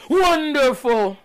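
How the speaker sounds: high, drawn-out theatrical cry; slow, high-pitched showman's exclamation; Broken Matt Hardy. Acted. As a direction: stretch it out like a grand hammed-up entrance. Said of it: "face to face with broken Matt Hardy".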